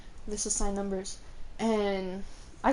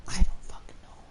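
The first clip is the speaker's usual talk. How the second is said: whispered aside; 4 dB louder than usual. Real, not acted. Unsure which period.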